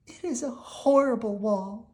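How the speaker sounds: high, pouting princess voice; sulky princess. Acted. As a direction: princess grumbling about the wall.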